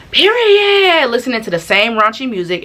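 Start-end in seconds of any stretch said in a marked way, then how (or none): 0.1-1.1 high loud period; mock hype shout. Imitating the obnoxious car behind, high loud. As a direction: yell it like the passing car. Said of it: "they're like".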